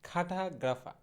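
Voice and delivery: খাটাগ্রাফা with aspirated kh and silent r's; corrected pronunciation. Shown as a demonstration. Teacher correcting cartographer for learners, খাটাগ্রাফা as "kha-tha-gra-fa". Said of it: "সঠিক ইংরেজিটা হবে হচ্ছে".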